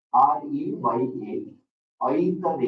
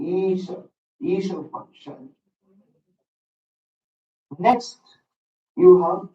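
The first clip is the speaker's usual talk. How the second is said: ordinary delivery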